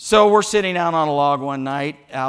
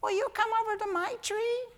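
tiny, very high, soft frog call; girl frog voice; 8 dB quieter than usual. Acted. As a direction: squeak it like a female frog calling. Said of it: "That's the girl frog. She's saying".

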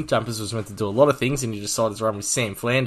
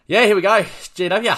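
excited; 9 semitones higher, 7 dB louder than usual. Real, not acted. Launching into team review.

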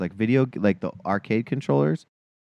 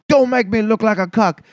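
high, loud imitation of a drunk guy; drunk guy at a bar; 10 semitones higher, 4 dB louder than usual. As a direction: ham up an aggressive drunk. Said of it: "and he was like".

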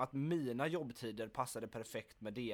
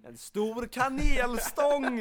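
gleeful echo; 11 semitones higher, 12 dB louder, much slower than usual. Real, not acted. Echoes friend's joke loudly.

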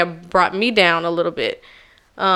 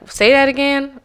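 teasing request; 7 semitones higher, 3 dB louder than usual. Retold or imitated. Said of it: "it would be like a joke".

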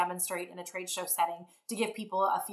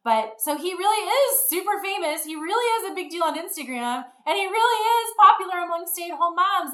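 amused; 9 semitones higher, 6 dB louder than usual. Real, not acted. Amused payoff.

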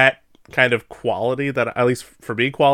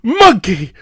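shouted quote; 10 semitones higher, 5 dB louder than usual. Retold or imitated.

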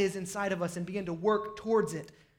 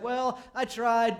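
mock excuse; 3 semitones higher, 3 dB louder, much slower than usual. Mimics a quitter's excuse.

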